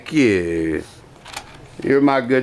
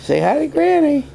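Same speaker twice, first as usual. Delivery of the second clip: high coaxing voice; 14 semitones higher than usual. Real, not acted. Coaxing toddler to phone.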